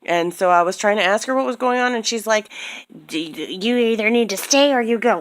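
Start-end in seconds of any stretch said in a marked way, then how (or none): 3.1-5.2 higher, snippy mimicry of the door staff; mocking the rude woman at the door; mocking her attitude. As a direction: imitate her bratty tone. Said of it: "she gave me some fucking crazy attitude".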